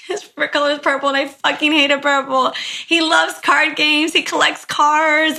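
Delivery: a mild hint of a tearful mother listing facts; grieving wife. Grieving wife confirms details.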